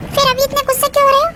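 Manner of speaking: coaxing plea. Acted. Wife coaxes angry husband.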